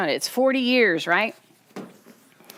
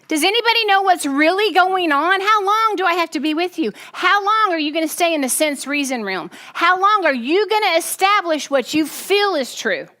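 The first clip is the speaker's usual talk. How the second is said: exasperated plea; 7 semitones higher than usual. Retold or imitated. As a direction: press them, fed up and pleading. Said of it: "he's like".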